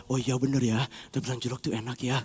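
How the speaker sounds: very low, hushed agreement; tempted musing. Acted. Soul yielding to temptation, low and hushed. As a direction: muse softly as you give in.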